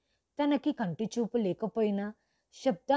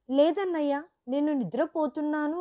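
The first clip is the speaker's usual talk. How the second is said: sister's voice; 5 semitones higher than usual. A narrator's reading. Sister's voice answering brother.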